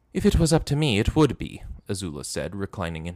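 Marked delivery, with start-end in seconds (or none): 0.1-1.6 put-on much higher, louder girl's voice; haughty princess, pitched well up; Azula's voice. A narrator's reading. Azula taunting her captive, high and loud.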